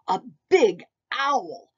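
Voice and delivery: ordinary delivery, nothing marked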